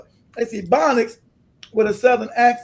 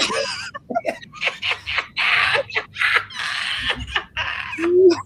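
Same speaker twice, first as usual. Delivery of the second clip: very high, drawn-out cackle; real high-pitched laughing fit; long shrieking laugh. Real, not acted. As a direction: burst into a long, squealing laugh.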